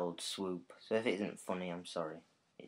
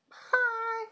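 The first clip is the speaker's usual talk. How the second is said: squeaky falsetto hi, far higher; woman's voice; 5 dB louder than usual. Pretend. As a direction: chirp it in a very high falsetto. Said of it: "That is my woman's voice".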